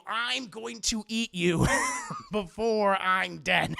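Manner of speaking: playful cannibal threat breaking into laughter; mock savage threat. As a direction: stretch each word into a hammed-up menace. Acting out own dying threat.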